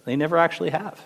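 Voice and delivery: ordinary delivery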